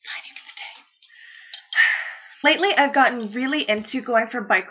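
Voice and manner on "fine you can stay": whispered, giving in to the dog; whispered aside. Real, not acted. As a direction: whisper the give-in to the pup.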